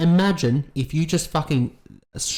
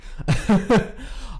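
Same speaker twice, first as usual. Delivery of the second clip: laugh; 7 semitones higher than usual. Real, not acted. Laughs at the voice crack.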